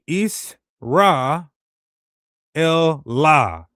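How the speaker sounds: Is, Ra, El, La split into separate beats; syllable by syllable. Shown as a demonstration. Spelling out a hypothetical Greek name, syllable by syllable. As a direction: break the name into syllables with pauses. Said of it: "it would have had the E".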